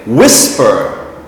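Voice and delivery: "whisper" with WHIS stressed, then falling; stress on first syllable. Shown as a demonstration. Teacher showing where stress falls, first-syllable stress on "whisper". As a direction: lean on the front of "whisper", WHIS-per. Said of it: "is in the front of the word".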